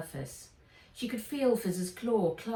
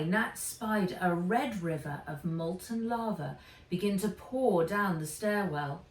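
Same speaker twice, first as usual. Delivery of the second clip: plain reading voice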